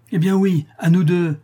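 high, loud acceptance of a challenge; fierce cry. A narrator's reading. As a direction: throw the challenge back at full voice. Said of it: "s'écria la veuve".

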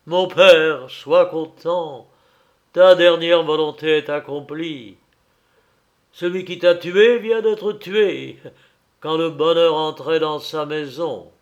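loud, proclaimed to the heavens; exalted outcry. Acted. Son addressing his dead father in the sky, loud exalted cry. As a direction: declaim it skyward in triumph. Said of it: "éclatant dans un moment d'exaltation".

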